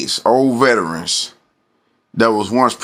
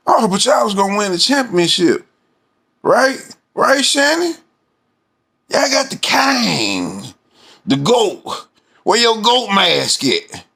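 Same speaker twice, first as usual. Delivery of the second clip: high sing-song taunt at Shannon; mocking taunt; 11 semitones higher than usual. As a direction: tease him in a high mocking voice. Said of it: "mocking Shannon's boasts".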